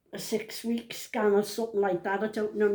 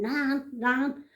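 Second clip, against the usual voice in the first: imitated shouting; 5 semitones higher than usual. Granddaughter calling to her nan in the street, mock shout. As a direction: mimic someone calling for attention. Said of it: "she's shouting".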